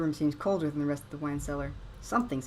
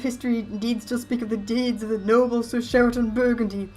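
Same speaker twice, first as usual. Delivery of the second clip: ghost voice; 5 semitones higher, 9 dB louder than usual. Acted. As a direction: moan it like a wronged ghost. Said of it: "ghost voice".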